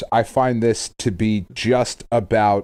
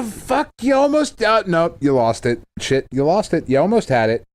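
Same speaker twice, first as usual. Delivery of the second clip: much higher, mock exasperated cries at a near miss; mock frustrated fan; 7 semitones higher than usual. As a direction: groan at the film as it blows it. Mocking a near-miss movie.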